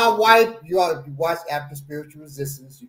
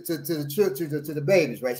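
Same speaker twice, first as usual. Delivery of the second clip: usual speaking voice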